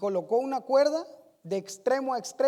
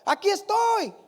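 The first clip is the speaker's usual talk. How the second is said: put-on higher, louder eager child's call; child shouting; 7 semitones higher, 4 dB louder than usual. Niño en el público se ofrece, gritando con entusiasmo. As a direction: shout it out like a kid volunteering. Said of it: "un niño que gritaba".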